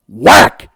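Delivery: shouted sound effect of a spatula hit; loud whack. Retold or imitated. Narrator shouting the spatula smacking his brother's face. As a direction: bark out the slap sound.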